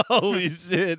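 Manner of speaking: laughing in disbelief; cracking up mid-word. Real, not acted. Shocked laughing reaction.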